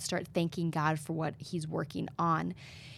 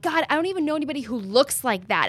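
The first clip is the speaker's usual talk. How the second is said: higher, louder and fast, venting at God; angry outburst; 7 semitones higher, 7 dB louder, faster than usual. Retold or imitated. Retelling her waking outburst to God, angry and fast. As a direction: blurt it out fed up and fast. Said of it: "I was so angry".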